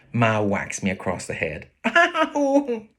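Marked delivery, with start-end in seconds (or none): 1.9-2.9 put-on boy's yelp of pain. Boy hit on head.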